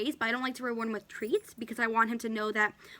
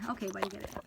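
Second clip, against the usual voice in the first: soft coaxing; 2 semitones lower, 6 dB quieter, much faster than usual. Real, not acted. Softly urging dog to take treat.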